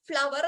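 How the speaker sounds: ഫ്ലവർ with a sounded v, as "flav-ver"; mispronounced flower. Shown as a demonstration. English teacher showing learners the wrong way, mispronounced. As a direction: say ഫ്ലവർ in two syllables with a v. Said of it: "എന്നല്ല pronounce ചെയ്യേണ്ടത്".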